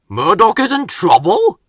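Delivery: exclaimed. Acted. Fat Controller exclaiming on the phone, surprised. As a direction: jolt upright in surprise. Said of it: "he exclaimed".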